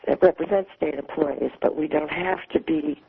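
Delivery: usual speaking voice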